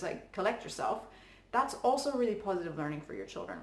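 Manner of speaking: normal talking voice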